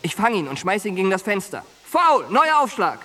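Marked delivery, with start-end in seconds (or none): 1.8-3.0 loud high umpire call. Acted. Al calling the foul like an umpire in the cell. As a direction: call it like a referee.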